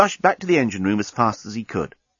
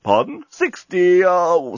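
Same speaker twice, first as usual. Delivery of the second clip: talking bomb; 7 semitones higher, 3 dB louder, slower than usual. Acted. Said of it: "said the bomb".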